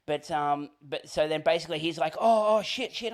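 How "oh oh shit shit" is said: higher, flustered mock panic; nervous panic. Guest realises he asked the wrong waiter, flustered. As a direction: imitate a man suddenly getting nervous. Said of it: "he gets all, like, nervous".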